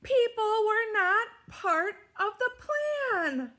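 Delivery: high, loud and slow with dismay; alarmed. A narrator's reading. Narrator as a crowd spoils Scaredy's plan, high and alarmed. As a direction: sound the alarm as the plan falls apart. Squirrel's plan fails at beach.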